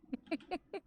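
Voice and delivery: high-pitched burst of laughter; high squeal laugh. Real, not acted. Laughing at the joke.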